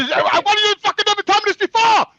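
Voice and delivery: high, loud accusing yell at his mother; retold angry outburst. Son yelling at his mother over the secret curse, high and loud. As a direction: demand to know why she never told him.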